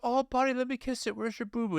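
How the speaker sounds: high, softened daddy coo; comforting dad. Retold or imitated. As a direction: soothe a little boy with a scrape. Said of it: "And I go".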